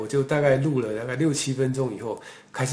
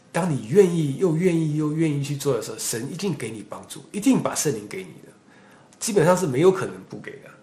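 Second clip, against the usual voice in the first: forceful preaching; 3 semitones higher than usual. Acted. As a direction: preach it hard to the congregation.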